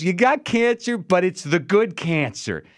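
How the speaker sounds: very high, slow mock-cheery delivery; smiling doctor. Imitating dermatologist giving biopsy results to the patient, smiling cheerily. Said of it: "he's smiling".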